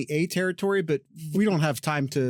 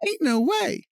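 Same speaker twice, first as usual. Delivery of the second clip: much higher, put-on silly voice; mock disbelief; 10 semitones higher, slower than usual. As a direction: squeak it out in mock shock. Repeats phrase in a silly voice.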